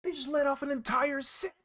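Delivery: high-pitched, startled outburst; alarmed shock. Real, not acted. Streamer hearing gunshots outside mid-chat, shocked high yelp. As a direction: blurt it out high and loud in real alarm.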